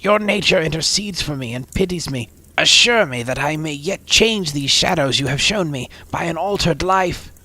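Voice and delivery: high, loud, urgent pleading; fervent plea. Acted. Scrooge falls before the spirit and begs, high and loud. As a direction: beg with all your heart.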